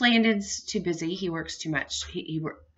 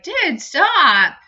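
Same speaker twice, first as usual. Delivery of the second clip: retold exasperated call; 9 semitones higher, 9 dB louder than usual. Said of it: "And it's like".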